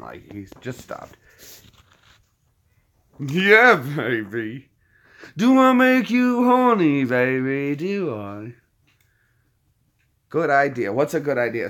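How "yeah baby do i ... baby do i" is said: Austin Powers impression. Fan quoting Austin Powers in a hammed-up British swinger voice. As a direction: purr it like a cheesy London lothario. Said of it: "He is the pimp of London".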